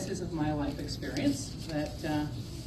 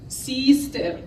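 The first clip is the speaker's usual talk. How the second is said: put-on old priest's French accent, slow and loud, laughing through it; thick French accent; 5 semitones higher, 11 dB louder, slower than usual. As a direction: imitate a kindly exasperated French Jesuit. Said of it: "said in his thick French accent".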